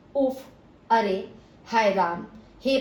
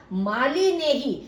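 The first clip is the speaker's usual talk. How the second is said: slow and louder, Mali stressed; 4 semitones higher, 4 dB louder, slower than usual. Shown as a demonstration. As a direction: punch Mali, then ही. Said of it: "माली के ऊपर विशेष बल". (माली पर विशेष बल)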